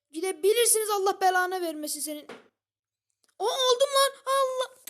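sudden burst of gamer rage, high and loud; raging yell at the screen; furious shout. Real, not acted. Raging at game mid-sentence.

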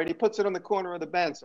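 ordinary delivery

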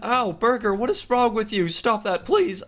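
high-pitched pained complaint; pleading protest. Acted. Player to the attacking burger, high pleading protest. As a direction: beg the attacker to stop, voice pushed up high.

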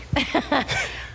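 light breathy chuckle; a rueful little laugh; soft laugh. Acted. Laughing softly at old memories.

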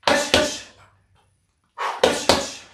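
sharp whispered hush with each punch; hush exhales. Shown as a demonstration. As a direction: breathe out hard on every hush. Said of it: "Letting those hush, hush punches snap out".

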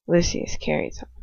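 normal talking voice

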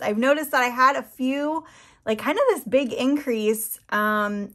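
usual speaking voice

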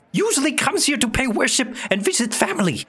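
high broken-English goblin; squeaky little goblin merchant. Acted. Goblin voice continues.

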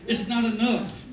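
ordinary delivery

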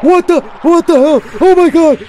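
very high, very loud screaming; terrified scream. Real, not acted. Gamer screaming at a sudden scare. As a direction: shriek in total panic. Jump scare in horror game.

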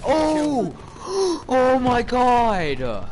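high gasp, then slow loud Oh my god; shocked exclamation. Real, not acted. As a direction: caught off guard by the play. Sudden big play in game.

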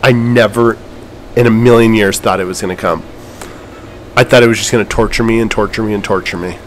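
quiet, tearing-up voice; softly choked up; emotional. Real, not acted. Gamer tearing up after the win, quiet emotional voice. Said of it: "I'm, like, emotional".

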